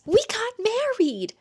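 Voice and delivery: high, loud exclaimed announcement; announcing. Retold or imitated. Father telling his daughter he remarried, loud and high. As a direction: belt it out like big happy news.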